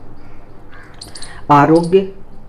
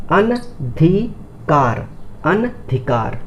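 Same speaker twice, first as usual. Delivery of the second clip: slow careful word; 3 dB quieter, much slower than usual. Shown as a demonstration. Teacher spelling for students, very slow. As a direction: stretch अनधिकार very slowly, अन apart. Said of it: "इसको समझते हैं".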